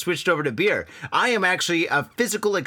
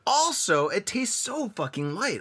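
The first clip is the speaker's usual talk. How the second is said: usual speaking voice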